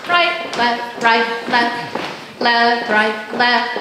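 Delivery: marching call. Shown as a demonstration.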